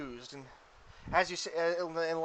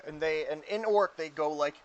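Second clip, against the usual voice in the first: ordinary talk, nothing marked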